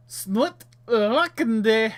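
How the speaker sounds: loud, high, drawn-out foreign gibberish; hammed-up character shout; put-on twin voice. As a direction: stretch out the odd words loudly. Voices the twins in story.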